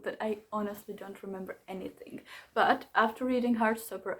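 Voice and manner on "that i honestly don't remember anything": sheepish whispered admission with a laugh. Real, not acted. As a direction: whisper it like a guilty secret. Admitting she forgot the book.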